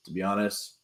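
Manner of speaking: low, quiet athlete voice; a hint of a laid-back player; D K impression. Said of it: "this is my D K's".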